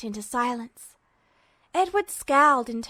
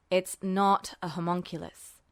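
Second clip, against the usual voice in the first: lower, a touch annoyed; quiet, mildly irritated correction; 6 semitones lower, 3 dB quieter than usual. A narrator's reading. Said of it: "as though mildly annoyed".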